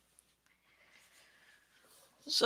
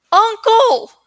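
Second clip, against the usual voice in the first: higher, louder desperate call; 10 semitones higher, 7 dB louder than usual. Acted. Eragon searching the wreckage for his uncle, a loud desperate call. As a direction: call into the ruins for him. Boy calling for missing uncle.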